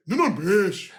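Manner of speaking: put-on Brazilian pronunciation of Mendes, ending in a "sh"; hammed-up Brazilian accent on the name. Shown as a demonstration. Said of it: "It's not Nuno Mendes".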